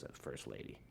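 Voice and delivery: very low, near-whispered aside; hushed confiding. Retold or imitated. Secret Service agent to the musician in the hall, hushed. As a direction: imitate an agent keeping it discreet.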